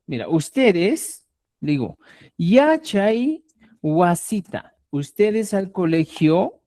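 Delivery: slow, drawn out for the class; slow explaining. Shown as a demonstration. Teacher slowing down so students follow, slow. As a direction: spell it out slowly. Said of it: "explaining slowly to students".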